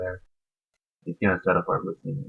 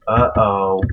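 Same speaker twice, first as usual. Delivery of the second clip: loud uh-oh; 7 dB louder than usual. Real, not acted. Alarm at enemy move.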